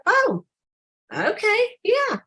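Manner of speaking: light up like a keen customer; eager yes. Retold or imitated. Eager prospect agreeing at booth.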